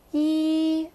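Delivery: "yi" held long, high and level; first tone. Shown as a demonstration. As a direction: sustain "yi" on one even pitch. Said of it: "first one is a horizontal bar".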